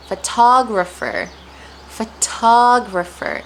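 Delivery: pho-TA-grapher, emphatic teacher demo; stress on TA. Shown as a demonstration. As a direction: punch the second syllable of "photographer". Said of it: "The stress is placed on ta".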